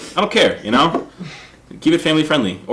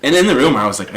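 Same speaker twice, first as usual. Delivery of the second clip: ordinary talk, nothing marked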